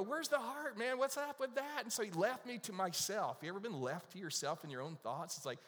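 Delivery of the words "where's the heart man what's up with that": much higher, louder mock outrage; a hurt student protesting to himself; indignant protest. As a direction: squeak it out in wounded disbelief. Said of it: "And I go".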